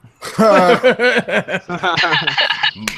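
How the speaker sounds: loud, high laughter; real loud belly laugh. Real, not acted. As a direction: burst out in a long laugh. Laughing after punchline.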